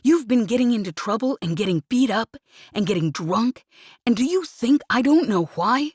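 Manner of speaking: furious rant. Acted. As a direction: pour out guilt and rage at full volume. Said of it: "he snapped furiously".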